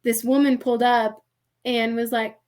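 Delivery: usual speaking voice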